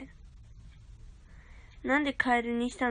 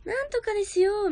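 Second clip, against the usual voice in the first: lightly high voice; 8 semitones higher, 4 dB louder than usual. Shown as a demonstration. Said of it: "高い声も出せるし".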